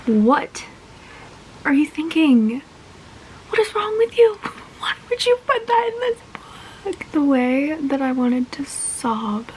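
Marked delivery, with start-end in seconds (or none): 3.5-6.2 breathy whisper, pitched up; whispered disbelief. Real, not acted. Reader scolding the author, shocked whisper.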